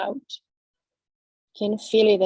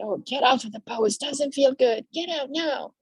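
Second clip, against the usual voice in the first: rising higher and higher, whiny and impatient; nagging inner mind; 6 semitones higher than usual. Acted. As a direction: play the restless mind begging to quit. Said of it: "your mind will try to fight you, will say".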